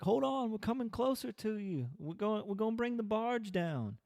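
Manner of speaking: very high, put-on calling voice; oddly calm bargemen. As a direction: imitate unruffled boatmen calling up from below. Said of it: "they're saying it so calm".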